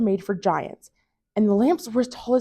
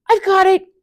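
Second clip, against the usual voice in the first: high, loud eager shout; eager cry; 11 semitones higher, 7 dB louder than usual. A narrator's reading. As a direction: jump in to help. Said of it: "cried Moose".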